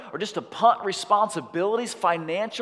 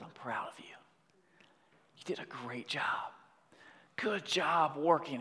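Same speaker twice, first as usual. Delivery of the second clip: tender praise; 9 dB quieter than usual. Retold or imitated. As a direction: voice a grandfather quietly praising his grandson. Grandfather praises the tired boy.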